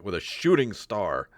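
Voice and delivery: ordinary talk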